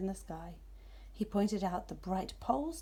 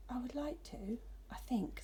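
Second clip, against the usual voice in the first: timid whisper; 3 semitones higher, 8 dB quieter than usual. Acted. Nervous owlet answers cat.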